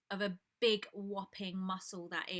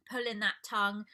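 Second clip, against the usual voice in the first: ordinary talk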